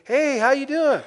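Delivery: bright, loud, high friendly hello; cheery greeting. Retold or imitated. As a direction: greet them like a cheerful neighbour. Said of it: "somebody says".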